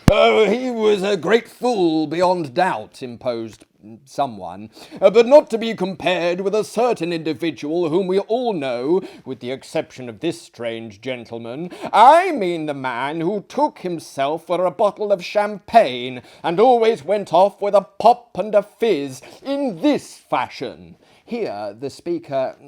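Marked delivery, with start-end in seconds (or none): 0.1-3.0 high, loud eager guest; excited diner. Acted. 5.0-21.0 high, loud, gleeful storyteller; eager dinner guest. Acted. Guest tells of champagne man.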